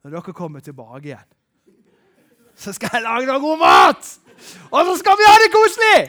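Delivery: high, loud delighted gush with a laugh; cheerful hostess promising food; cosy type gushing. Retold or imitated. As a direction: burst with joy about cooking for everyone. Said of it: "den som bare har det koselig".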